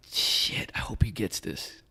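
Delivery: whispered inner hope; anxious private whisper. Retold or imitated. Officer silently hoping lieutenant catches on, anxious whisper. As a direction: whisper it under your breath, hoping.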